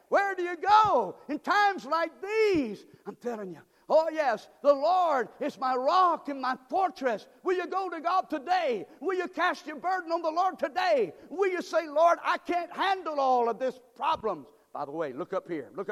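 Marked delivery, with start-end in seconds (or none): 0.1-2.9 high, loud, slow preacher's cry; full-force preaching. Acted. 3.9-14.5 full-force preaching. Acted.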